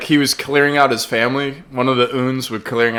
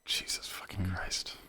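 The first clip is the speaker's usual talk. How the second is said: whispered exasperation; 8 semitones lower, 23 dB quieter, slower than usual. Real, not acted. Disbelief at a bandmate's answer.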